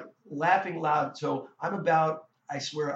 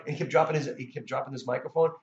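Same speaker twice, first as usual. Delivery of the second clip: ordinary delivery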